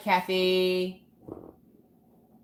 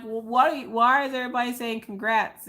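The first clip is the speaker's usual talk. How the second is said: high-pitched confused questioning; mock baffled reaction; retold bewilderment; 8 semitones higher than usual. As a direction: stumble into a puzzled question. Retold groggy confusion at messages.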